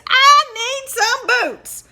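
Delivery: high, loud, slow drawling cowboy. Acted. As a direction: boom it out like a big cowpoke. Cowboy character voice.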